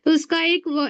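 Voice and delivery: ordinary delivery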